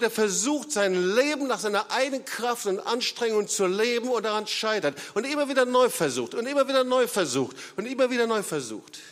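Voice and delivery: high, loud, driving sermon climax; preaching at full force. Acted. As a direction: hammer the point home to the congregation. (eindringliches, lautes Predigen)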